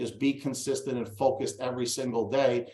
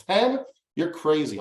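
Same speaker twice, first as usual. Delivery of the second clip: incredulous; 4 semitones higher than usual. Retold or imitated. Retelling his protest to a friend who demands ten calls, incredulous. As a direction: balk at it, pitch leaping up on the first word.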